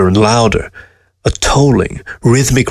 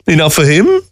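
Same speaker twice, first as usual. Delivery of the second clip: high, derisive question; mocking comeback; scoffing; 7 semitones higher than usual. A narrator's reading. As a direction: scoff it back at a raised pitch. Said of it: "she scoffed".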